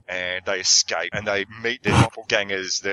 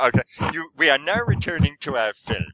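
mock announcer; 5 semitones higher than usual. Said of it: "they say".

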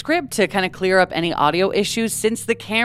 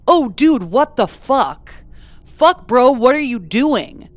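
raised, alarmed mock yell; a hint of panicked yelling; retold yelling; 6 semitones higher, 6 dB louder, slower than usual. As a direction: call out the alarmed quote louder. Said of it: "he can be heard yelling".

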